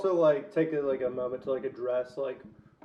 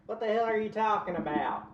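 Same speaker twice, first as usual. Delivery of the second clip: higher, thickly put-on Southern accent; 5 semitones higher than usual. Imitating friends from growing up, very thick Southern accent. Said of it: "kind of Southern accent".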